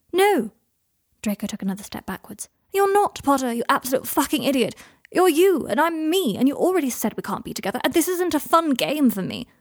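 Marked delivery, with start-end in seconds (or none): 0.1-0.5 sharp, high and loud refusal; emphatic denial. Acted. Emphatic denial in a lovers' argument. 2.7-9.5 high, loud, exasperated rant; heated lover's protest, voice raised; agitated outburst. Acted.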